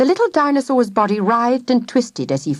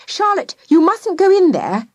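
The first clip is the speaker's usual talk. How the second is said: higher, pleading mother voice; 10 semitones higher, 5 dB louder than usual. A narrator's reading. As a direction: beg her daughter to stop. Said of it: "pleaded Missus Spruce".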